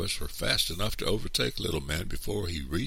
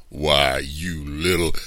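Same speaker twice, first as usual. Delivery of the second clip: put-on loud growl at a child, pitch held down; angry cry; 6 dB louder, much slower than usual. A narrator's reading. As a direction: lunge at the boy with your voice. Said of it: "Mister Anderson cried".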